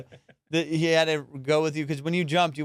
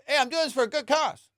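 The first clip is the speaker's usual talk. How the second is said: mocking high do-gooder voice; fake do-gooder; 7 semitones higher than usual. Mocking the naked charity skydiver as a fake do-gooder, high and sneering. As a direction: sneer at a self-righteous charity pitch. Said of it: "with the veil of".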